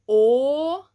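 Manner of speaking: loud, open-mouthed "o"; open vowel. Shown as a demonstration. Teacher projecting the third vowel to class. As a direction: open wide on "o". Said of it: "the sounds come from the middle".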